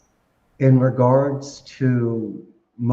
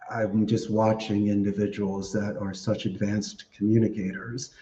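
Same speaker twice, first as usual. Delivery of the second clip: ordinary talk, nothing marked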